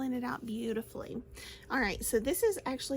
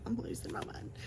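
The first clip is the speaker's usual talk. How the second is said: whisper; 4 dB quieter than usual. Real, not acted. Laughing at own slip.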